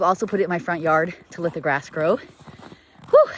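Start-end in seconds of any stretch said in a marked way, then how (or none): 3.1-3.3 out of breath. Real, not acted. Farm owner on a tour in the cold, out of breath. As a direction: catch your breath while talking. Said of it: "Sorry, I'm out of breath".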